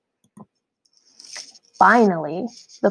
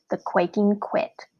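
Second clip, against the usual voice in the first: plain reading voice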